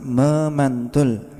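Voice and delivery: "memantul" stretched out syllable by syllable; measured, drawn-out "memantul"; slow emphasis. Shown as a demonstration. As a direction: spell out "memantul" slowly for the class. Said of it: "huruf yang punya sifat".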